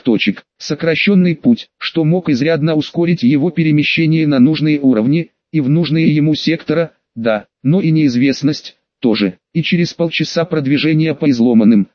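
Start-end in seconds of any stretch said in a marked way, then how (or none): none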